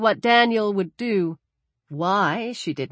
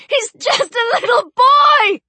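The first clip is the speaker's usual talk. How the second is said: high, loud shout of despair; desperate, high-pitched wail; anguished cry; 6 dB louder than usual. Acted. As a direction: scream in protest for a child. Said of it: "she cried".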